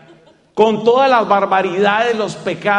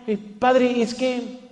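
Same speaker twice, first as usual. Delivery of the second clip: put-on nervous child; 3 semitones higher, 4 dB quieter than usual. Nervous child stammering at confession.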